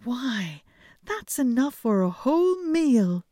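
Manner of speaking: high, loud delighted princess; pleased princess exclaiming; cartoon princess. A narrator's reading. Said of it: "said the princess".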